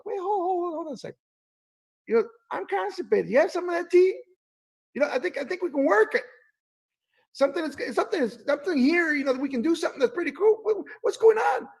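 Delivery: high, eager friend's voice, rushing; Paula's voice. Retold or imitated. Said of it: "Paula goes".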